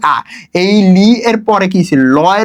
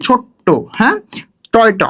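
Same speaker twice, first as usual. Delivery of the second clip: ordinary talk, nothing marked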